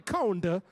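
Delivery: 'corner' said 'conder', with a d sound in the middle; New Orleans corner. Shown as a demonstration. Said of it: "we put a D in the corner".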